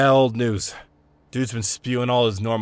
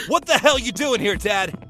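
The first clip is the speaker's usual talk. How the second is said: startled; 10 semitones higher than usual. Acted. Son startled to find drunk dad.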